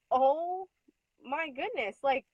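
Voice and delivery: high, rising seriously in disbelief; exasperated disbelief. Retold or imitated. Upset at the conference, high exasperated seriously. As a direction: throw up your hands in disbelief. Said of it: "And I'm just like".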